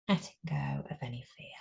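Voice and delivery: guided meditation. Acted. Teacher guiding a group through breathing, calm meditation voice. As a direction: ease them into stillness.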